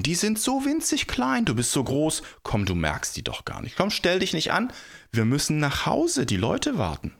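high-pitched, impatient persuading; exasperated coaxing. A narrator's reading. People talk the giant round, exasperated coaxing. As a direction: talk a big baby round.

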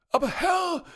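high, loud servant outcry; startled servant squeak; squeaky servant cry. A narrator's reading. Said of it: "rief der Diener".

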